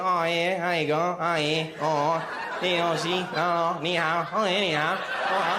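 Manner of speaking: low, slurred mock Australian accent; broad Aussie mumble, imitated; deep Australian accent. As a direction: parody a thick Australian accent until it sounds Chinese. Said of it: "like, a very deep Australian accent".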